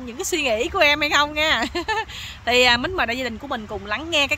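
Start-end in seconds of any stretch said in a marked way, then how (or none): 1.7-2.4 soft, high giggle; real light giggle, pitched high; teasing laugh. Real, not acted. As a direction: chuckle quietly at your own tease. Laughs after teasing the audience.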